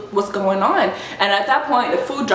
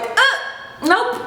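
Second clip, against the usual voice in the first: high, short mock refusal; quick refusal; 13 semitones higher than usual. She stops his second hug with her fingers, refusing. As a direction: block it with a high-pitched nope.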